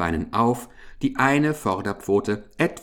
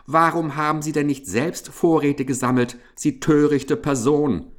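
higher, hurried scolding; snarling rebuke; 6 semitones higher, 3 dB louder than usual. A narrator's reading. Hamster scolding mouse for not gathering stores, snarling. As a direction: snap crossly at a fool. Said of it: "fauchte Ambrosius Dauerspeck sie an".

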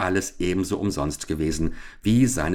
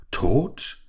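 higher, startled question; well-feigned horror; 4 semitones higher than usual. Acted. Richard hearing of his friend's death, feigning shock. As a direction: act shocked at the news. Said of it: "schrie Richard in gut gespieltem Schrecken".

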